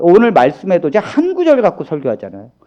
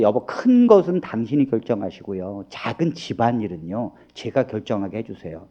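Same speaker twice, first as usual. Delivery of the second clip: soft coaxing wife; 11 semitones lower, 11 dB quieter than usual. Shown as a demonstration. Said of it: "미리 결정을 해라니까".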